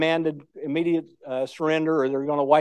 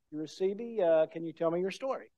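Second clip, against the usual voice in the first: put-on much softer, hushed young woman's question; gentle quoted question; 12 dB quieter than usual. Young lady holding the old veteran's hands, asking much softer. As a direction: ask tenderly, almost under your breath. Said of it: "she takes his hands and she says".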